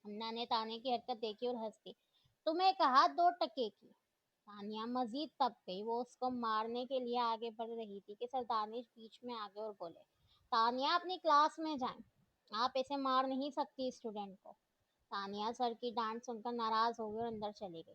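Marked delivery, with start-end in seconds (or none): 2.5-3.9 much louder and higher, punching तुम्हें; girl taunting her rival loudly. Acted. Taunting back in quarrel. 10.5-14.5 louder, stern teacher command; teacher ordering a student back to class, firm and louder; firm scolding. Acted. Teacher stops a fight.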